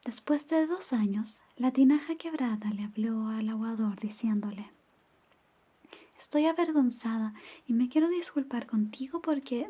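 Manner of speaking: ordinary delivery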